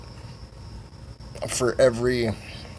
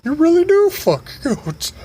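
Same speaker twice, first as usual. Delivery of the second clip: very high, drawn-out cackling character; squealing mockery; 3 dB louder, slower than usual. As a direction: tease in falsetto, stretching the words. Voices Sugar Tits mocking Steve.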